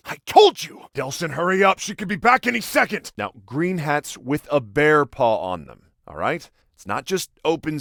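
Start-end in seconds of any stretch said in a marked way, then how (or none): 0.1-3.1 high, loud burst, then pressing urgency; urgent outburst. Acted. Brother hurrying Delsin before she comes back, urgent calling. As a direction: cry out and rush him along.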